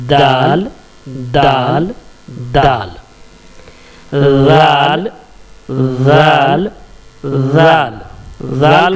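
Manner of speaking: दाल with the tongue tip on the upper tooth roots; letter demo. Shown as a demonstration. Teacher demonstrating the letter to the class, crisp d. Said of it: "देखिए, जुबान की नोक को सटा करके".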